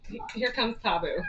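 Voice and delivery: ordinary talk, nothing marked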